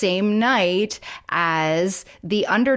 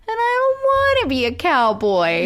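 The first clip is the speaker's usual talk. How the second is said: put-on high whiny pouty kid voice; whiny little boy voice; 9 semitones higher than usual. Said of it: "in that little whiny voice".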